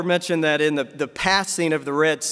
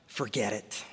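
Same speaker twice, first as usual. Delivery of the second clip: low, much softer, dropping away; resigned giving up; 8 semitones lower, 12 dB quieter than usual. Acted. Preacher voicing a worn-out believer, quiet resignation. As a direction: let it fall away quietly in defeat. Voicing someone who has given up hoping.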